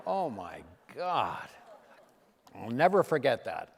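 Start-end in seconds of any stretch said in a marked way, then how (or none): 0.1-1.5 groaning in pain. Retold or imitated. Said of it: "I was going".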